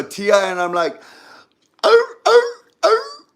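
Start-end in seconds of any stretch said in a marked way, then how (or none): 1.8-3.3 very high, loud mock wail; imitated cries of distress; high-pitched cries. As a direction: squeal out repeated ohs. Acted cries toward another person.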